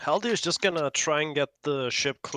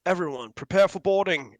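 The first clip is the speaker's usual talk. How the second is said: loud, raised call to the crew; yelled command; 6 semitones higher, 6 dB louder than usual. Acted. Haldir warns the crew of boarding, yelled command. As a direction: yell out orders as a ship's officer. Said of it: "he yells out".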